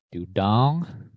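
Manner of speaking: ordinary talk, nothing marked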